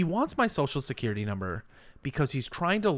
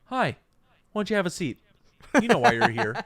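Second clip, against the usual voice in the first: Chris Hansen; 6 semitones higher, 4 dB louder, faster than usual. Retold or imitated. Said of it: "Chris Hansen inside going".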